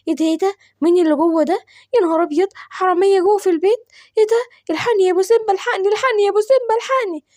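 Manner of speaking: she panics and shrieks for her husband; panicked cry for help. Acted. The wife sees thieves inside and cries in rising panic.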